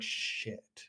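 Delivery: hushed, whispered curse; muttered dismay. Retold or imitated. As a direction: curse under your breath as the demon caught out. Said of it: "And the demon was like".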